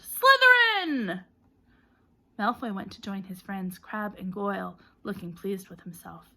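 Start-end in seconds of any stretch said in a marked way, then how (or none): 0.0-1.2 much higher, loud, slow scream; long drawn-out scream; hat screaming. A narrator's reading. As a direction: scream the house at once. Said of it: "when it screamed".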